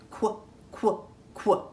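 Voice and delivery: "quack" cut down to a short "kwuh"; quacking duck sound. Shown as a demonstration. Teacher modelling the duck sound for children, "quack" as short "kwuh". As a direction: say "quack" as just its opening kw sound. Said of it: "is the quacking duck sound".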